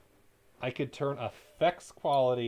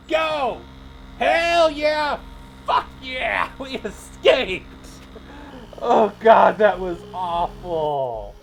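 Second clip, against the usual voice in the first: much higher and much louder, whooping; triumphant shout; 8 semitones higher, 11 dB louder, much slower than usual. Real, not acted. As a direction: yell with relief after the escape. Cat escapes, celebrating.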